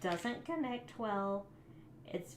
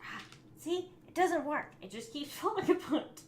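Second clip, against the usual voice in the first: higher and louder, exasperated, with a laugh; exasperated outburst; 8 semitones higher, 5 dB louder than usual. Real, not acted. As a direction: blurt it out as the model falls apart. The piece falls apart again.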